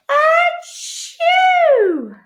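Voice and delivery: loud, high exploding sneeze; big bear sneeze. Acted. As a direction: let a huge sneeze burst out. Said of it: "makes the bear go".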